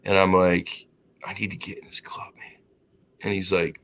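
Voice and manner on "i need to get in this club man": unvoiced hushed whisper; secretive whisper to the bouncer at the club door; whispered request. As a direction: whisper it so nobody else hears. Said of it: "you're gonna have to speak up".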